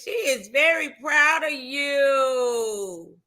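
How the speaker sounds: slow, drawn-out praise with a long falling YOU; slow sing-out of a compliment; proud relay. Real, not acted. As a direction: stretch the words out proudly, especially the last. Reads viewer praise, drawn out.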